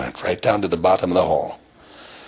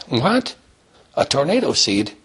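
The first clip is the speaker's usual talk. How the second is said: high, rising incredulous grandmother; astonished disbelief; 7 semitones higher than usual. Acted. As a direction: echo it back in high-pitched amazement. Grandmother hears of tornado seed.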